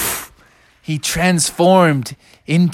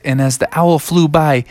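ordinary delivery, nothing marked

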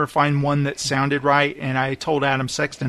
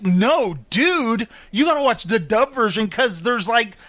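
higher-pitched, insistent arguing; animated self-quote, pitched up; retold protest; 6 semitones higher than usual. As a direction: argue back in mock indignation. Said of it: "And I'm like".

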